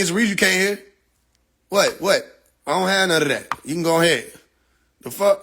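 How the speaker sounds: normal talking voice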